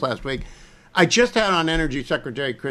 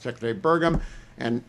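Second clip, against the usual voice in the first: usual speaking voice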